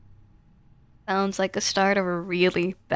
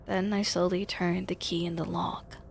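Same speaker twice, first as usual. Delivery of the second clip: whispered narration; 7 dB quieter than usual. A narrator's reading. Narrator locking up quietly.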